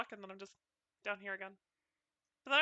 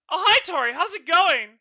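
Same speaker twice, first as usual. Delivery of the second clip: excited greeting; 7 semitones higher, 13 dB louder than usual. Real, not acted.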